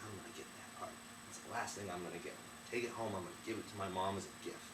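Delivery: soft whisper, barely voiced; hushed plotting. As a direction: Jack whispering his plan from the teapot. Jack plotting beside sleeping giant.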